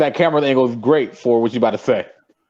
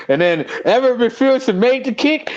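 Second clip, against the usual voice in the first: imitating co-host; 11 semitones higher than usual. Teasing co-host about his game recap, high mock excitement. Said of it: "You're gonna go like".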